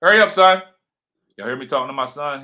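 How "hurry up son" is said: louder, higher call to hurry. Real, not acted. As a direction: raise your voice to hurry your son along. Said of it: "He probably think I'm yelling at him".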